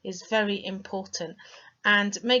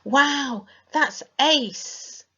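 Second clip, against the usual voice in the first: excited; 7 semitones higher, 8 dB louder, much slower than usual. Shown as a demonstration. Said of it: "we use that a lot".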